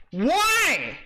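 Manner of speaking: high, loud cry of disbelief; exasperated shout. Real, not acted. Demanding a reason from the guide's writer, exasperated shout. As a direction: wail the question out high.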